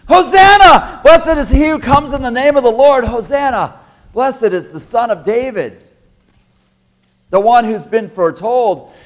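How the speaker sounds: high shouted praise, full volume; loud crowd shout. Acted. Preacher playing the Palm Sunday crowd, shouting praise. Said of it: "I get a little too, too loud".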